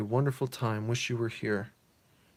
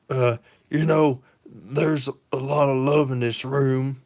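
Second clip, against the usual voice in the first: louder, slow tough-guy sentiment; gruff Bibbo; 3 semitones higher, 8 dB louder than usual. Acted. Bibbo speaks of love in the room, loud and slow.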